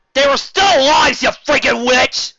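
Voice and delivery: high loud furious yell; furious shout. Acted. Girl yelling at the officer, furious shout. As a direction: scream it at her in rage. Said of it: "I was truly angry".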